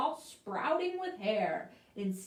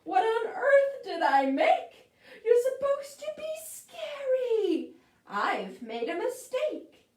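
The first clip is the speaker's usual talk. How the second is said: high shrill witch voice, way up and loud; screeching witch; 13 semitones higher, 6 dB louder than usual. Acted. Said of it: "screeched the witch".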